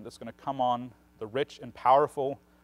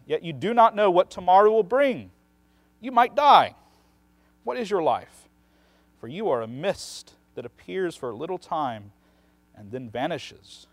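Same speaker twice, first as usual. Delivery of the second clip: impassioned scripture reading; 5 semitones higher, 8 dB louder than usual. Retold or imitated. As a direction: preach the warning hard, then let it fade like mist. Reading James's warning with rising force.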